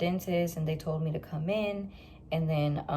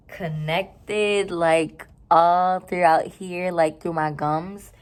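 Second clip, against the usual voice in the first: emphatic loud; 8 dB louder, slower than usual. Real, not acted. Stressing pain spot emphatically.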